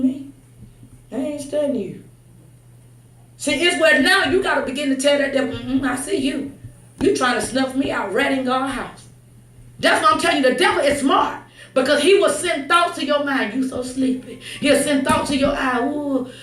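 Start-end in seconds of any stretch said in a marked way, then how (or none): none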